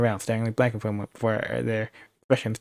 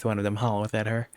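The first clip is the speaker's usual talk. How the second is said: ordinary delivery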